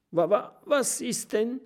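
high terrified stutter; petrified porcupine stutter; stammering. A narrator's reading. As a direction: stammer in fright. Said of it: "stottert das Stachelschwein".

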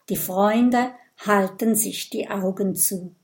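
usual speaking voice

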